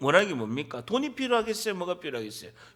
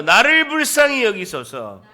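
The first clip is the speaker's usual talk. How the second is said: loud, slow plea to be repeated; preacher leading a shouted prayer; crying out; 10 dB louder than usual. Shown as a demonstration. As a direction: call out for mercy at full voice, drawing it out. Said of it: "그렇게 외치는 거예요".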